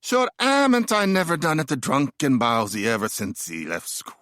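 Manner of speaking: hammed-up bad Irish accent; terrible Irish accent. Disappointed father in James Joyce's Dubliners, in a terrible Irish accent. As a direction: do a badly faked Irish father. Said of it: "that was a terrible Irish accent".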